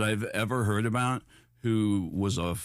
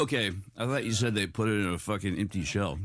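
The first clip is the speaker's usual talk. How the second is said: ordinary delivery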